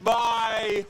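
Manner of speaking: very high, drawn-out shout of amazement; amazed cry. Real, not acted. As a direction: cry out slowly, loud and high, at the play. Commentator erupts at a big play.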